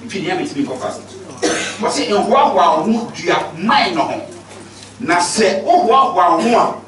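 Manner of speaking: ordinary talk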